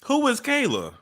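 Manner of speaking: surprised. Real, not acted. Reacting to clip.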